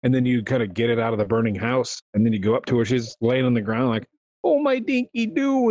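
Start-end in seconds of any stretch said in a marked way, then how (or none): 4.4-5.7 put-on high, slow squeaky old woman; bad old lady impression. Lady on the ground calls her pet, slow squeaky old lady. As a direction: croon it like a fake granny. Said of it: "impression of an old lady".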